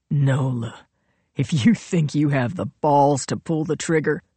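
lightly voiced menacing villain; a hint of a cold, daring taunt. Royal dares Nola to pull the trigger, taunting villain.

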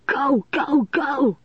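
high, urgent cry. A narrator's reading. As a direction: urge them to get away now. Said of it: "Lanny was crying".

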